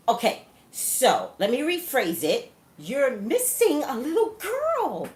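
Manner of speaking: acted, deliberately drawn-out restatement; slow emphatic rephrasing. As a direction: spell it out for people who missed it. Acts out rephrasing it for them.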